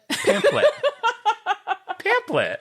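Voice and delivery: high, squeaky laugh through the word. Real, not acted. Host mocked by co-hosts for a word, helpless laughter. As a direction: try to say it while cracking up. Cracks up over own mispronunciation.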